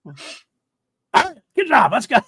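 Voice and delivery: high, loud, over-the-top cheerful boss; hammed-up pat on the back; imitating a boss faking praise to a flustered officer; mock hearty praise. Voicing Luthen praising Cyril.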